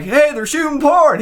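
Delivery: high, slow mock call across the yard; hammed-up neighborly yell. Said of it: "He was like".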